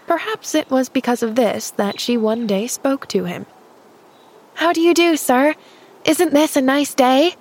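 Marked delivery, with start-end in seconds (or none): 4.6-7.3 put-on higher-pitched, cheerful girl's greeting; cheery call. A narrator's reading. Girl calling to a man as she approaches, cheery. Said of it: "she called cheerily as she approached him".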